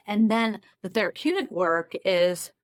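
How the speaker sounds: ordinary talk, nothing marked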